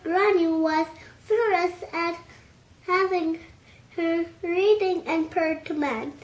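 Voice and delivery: usual speaking voice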